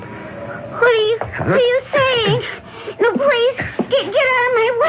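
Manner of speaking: frightened, pleading and halting; terrified woman begging her attacker. Acted. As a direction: beg him to let you go, voice shaking. Victim pleads with killer.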